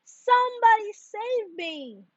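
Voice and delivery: high, put-on panicky plea; frightened cry. A narrator's reading. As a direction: beg to be rescued mid-sway. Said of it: "he shouted".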